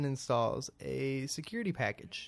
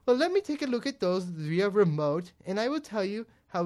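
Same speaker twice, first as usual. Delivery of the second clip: higher and louder, eager salesy pitch; mock support agent; 7 semitones higher, 7 dB louder than usual. Retelling the support call, agent pushes remote access in mock eager voice. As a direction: push the remote access like a script. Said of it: "He goes".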